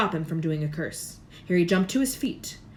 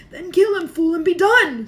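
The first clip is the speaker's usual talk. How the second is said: high, loud screech; Voldemort ordering the kill; 11 semitones higher, 11 dB louder than usual. A narrator's reading. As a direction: screech the command. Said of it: "screeched Voldemort".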